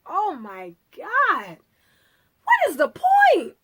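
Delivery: drawn-out, high, loud despair; hammed-up exasperation. Viewer fed up with an endless movie, exasperated. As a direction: wail it slowly, climbing to a squeal. Acted exasperated reaction to long film.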